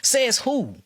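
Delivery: scornful high-pitched comeback; indignant challenge. Real, not acted. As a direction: fire the question back in disbelief. Challenging the experts' claim.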